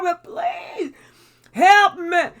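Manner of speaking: crying plea. Retold or imitated. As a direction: cry out for help at the end of your rope. Acted desperate prayer.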